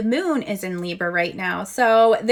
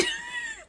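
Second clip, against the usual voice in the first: high squeaky gobble sound; turkey gobble; 11 dB quieter than usual. Pretend. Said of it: "that's my turkey impression".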